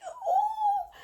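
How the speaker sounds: high, laughing ooh of amused surprise; laughing mock ooh. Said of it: "And I'm like".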